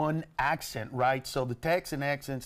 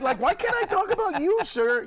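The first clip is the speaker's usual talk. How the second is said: laughing mock challenge; 6 dB louder than usual. Real, not acted.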